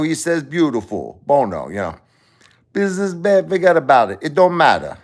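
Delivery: hammed-up Italian-American accent, up high; Sicilian pizzeria owner. Italian-American owner explaining rent, in a hammed-up Italian-American accent.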